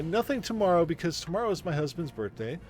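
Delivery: usual speaking voice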